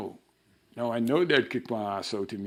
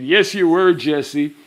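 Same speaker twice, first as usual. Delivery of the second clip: emphatic yes; 5 semitones higher, 12 dB louder, slower than usual. Retold or imitated. Answering Jesse's question.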